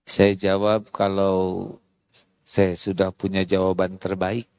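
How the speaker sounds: husband calmly answering angry wife. Retold or imitated. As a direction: soothe her gently, low and quiet. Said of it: "Apa jawabannya?".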